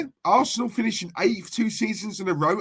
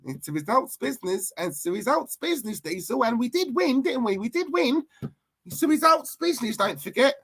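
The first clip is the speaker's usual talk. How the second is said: excitable put-on voice climbing high; mocking imitation; 6 semitones higher than usual. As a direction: mimic the defensive fan, rising in pitch. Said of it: "out you come with it".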